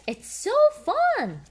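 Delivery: very high, slow, loud piglet boast; Peppa voice. A narrator's reading. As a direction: boast like a proud little pig girl. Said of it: "Peppa says".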